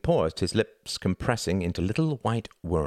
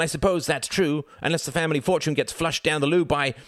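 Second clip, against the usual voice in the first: son railing at his father, louder and higher; indignant rising fury; 11 semitones higher, 4 dB louder than usual. Acted. Son berating his ruined father, loud and indignant. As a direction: pile on the outrage line by line. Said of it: "If you take that tone with me".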